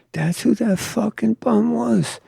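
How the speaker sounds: high-pitched, disbelieving question; incredulous. Retold or imitated. Tattooer learning who he kicked out, incredulous.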